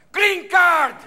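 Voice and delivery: high, loud triumphant call; referee's shout. Acted. Referee escalating the cards, shouted call. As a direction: belt it out as the final ruling. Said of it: "the referee comes over".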